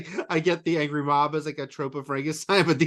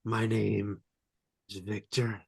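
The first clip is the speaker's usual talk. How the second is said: lower, softer, slow dramatic self-introduction; campy melodrama; 6 semitones lower, 8 dB quieter, slower than usual. Retold or imitated. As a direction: ham it up with heavy pauses between phrases. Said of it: "very campy because it's like".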